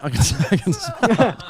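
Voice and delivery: mom line broken up by laughter; laughing impression. Comic redoing mom's line as a tag, helpless with laughter. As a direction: try the imitation while cracking up.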